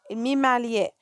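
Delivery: usual speaking voice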